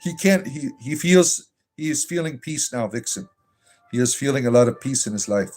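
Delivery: syrupy, sing-song reassurance, hammed-up; mock pastoral comfort. A mock pastor comforting Robert, syrupy and over-sweet. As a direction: lay the fake sympathy on thick like a gushing pastor.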